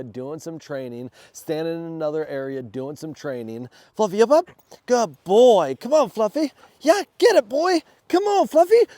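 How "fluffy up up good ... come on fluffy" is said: very high, loud, excited calls to the dog; excited calling. Real, not acted.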